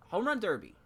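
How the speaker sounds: high, loud event announcement; announcer. Pretend. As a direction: call it out like a stadium announcer.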